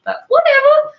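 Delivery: squeaky high whatever, loud; breezy dismissal. Real, not acted. Host shrugging off going live on the wrong page, high and loud.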